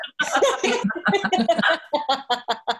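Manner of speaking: a bright high laugh. Real, not acted. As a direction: let out a laugh. Laughs after the joke.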